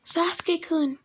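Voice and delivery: very high, put-on fangirl voice; fawning girls. Girls surrounding the two boys, high swooning voice. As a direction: swoon over the boys in a squeaky-high girly voice. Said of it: "So they be like".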